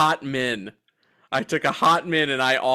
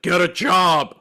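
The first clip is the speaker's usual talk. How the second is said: mock old-man yell, louder; grouchy yell; 3 semitones higher, 5 dB louder, slower than usual. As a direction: heckle a kid like a grumpy neighbor. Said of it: "did you yell Mister Wilson-esque things".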